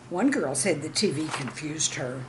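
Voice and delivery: ordinary delivery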